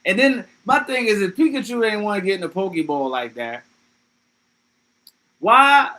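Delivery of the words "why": sudden outraged why; loud why. Real, not acted. Streamer questioning Pokemon logic, loud outraged why. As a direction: burst out higher and louder on why.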